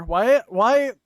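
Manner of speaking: loud, high exasperated protest; mock outrage. Real, not acted. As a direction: throw your hands up, why me. Mock outrage at Toby Maguire.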